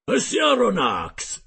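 slow, loud rough sailor voice; a hearty sailor, loud and slow; gruff harpooner Ned. Acted. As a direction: play the blunt Canadian harpooner, drawn out. Ned Land's voice in dialogue.

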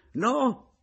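very high, loud outburst; indignant cry. A narrator's reading. Adriana objecting to the apology, indignant cry. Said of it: "gridò Adriana indignata".

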